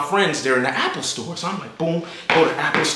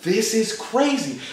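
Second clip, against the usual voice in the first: soft, amazed; quiet awe; 3 semitones higher, 6 dB quieter, slower than usual. Retold or imitated. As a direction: breathe it in disbelief.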